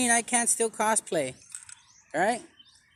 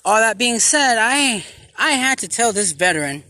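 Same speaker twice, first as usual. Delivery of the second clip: a lightly louder preacher's address; preaching; 5 dB louder than usual. Acted. To viewers about homeless veterans, a mild preaching voice. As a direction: proclaim it like a sermon.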